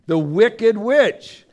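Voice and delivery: spooky storyteller naming the Wicked Witch; ominous villain announcement. Shown as a demonstration. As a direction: boom out the name like the witch is coming. Repeats villain's name dramatically.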